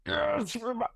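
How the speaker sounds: high, squeaky excited dog. Retold or imitated. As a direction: voice the dog, thrilled. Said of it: "the dog sees the park and it's like".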